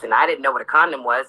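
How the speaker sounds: usual speaking voice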